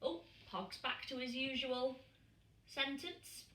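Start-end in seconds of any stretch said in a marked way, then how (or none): none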